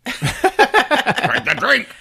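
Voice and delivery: very high, slow, laughing; slow laughing falsetto. Retold or imitated. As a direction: drag it out squeakily while laughing.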